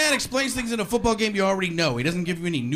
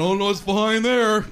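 mock dismay; 5 semitones higher, slower than usual. Mocking viewer, slow.

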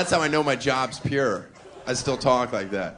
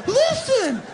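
whiny clingy partner; 4 dB louder than usual. Pretend.

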